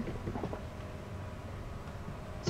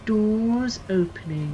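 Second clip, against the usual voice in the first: slow, drawn-out lift announcement, pitched up; Leicester Controls voice; 6 semitones higher, much slower than usual. Acted. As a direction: announce it like a dull recorded lift voice. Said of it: "There was Lester Control's voice".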